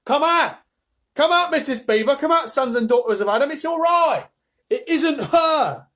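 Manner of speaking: loud, high, breathless beaver calling out; excited shouting. Acted. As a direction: yell the good news to the cave. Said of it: "he was shouting".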